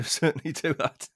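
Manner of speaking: usual speaking voice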